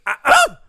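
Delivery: loud, high two-part tennis grunt; high-pitched grunt, punched on the second beat; double grunt. Retold or imitated. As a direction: imitate a straining player's "uh-UH". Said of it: "No, she was".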